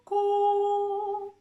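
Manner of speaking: high loud hooting "cu, cu"; owl hoot. Shown as a demonstration. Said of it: "o un búho". (ulular de búho agudo)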